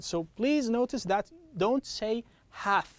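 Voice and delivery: ordinary talk, nothing marked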